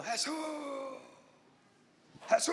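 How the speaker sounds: muted mock scream of a distressed woman; held-back, wailing call; imitated crying out.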